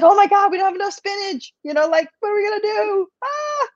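comic shrill fright over missing spinach; mock panic. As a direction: play up the spinach crisis. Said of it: "Be like".

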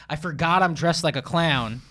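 normal talking voice

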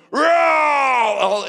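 loud, high imitated alligator roar with a cry in it; gator roar. Pledge in icy water ordered to sound like a gator, roaring loudly. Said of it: "sound like a real live angry gator".

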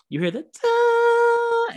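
a long high held synth tone; imitated synth note. Shown as a demonstration. Imitating the synth intro he heard at the show. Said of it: "you hear an iconic synth".

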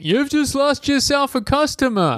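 customer quote. Retold or imitated. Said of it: "In Flaming Moe's".

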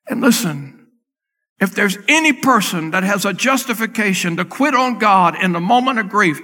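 loud, rising preacher's climb; preaching at full force. Acted. Preacher urging the congregation about Mary, loud and climbing.

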